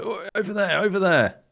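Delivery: high-pitched urgent direction, in character; Griff calling out. Acted. Griff points down the corridor, calling out urgently. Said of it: "Griff will point over to the back of the corridor and go".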